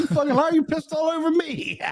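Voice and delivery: put-on high, indignant kid retort; a kid accusing his cousin of wetting him, mock outrage; mock accusation.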